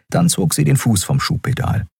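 ordinary delivery, nothing marked

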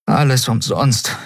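mildly desperate sigh of defeat; despairing. Acted. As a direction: voice the lament aloud, dejected. Despairing, first take before whisper.

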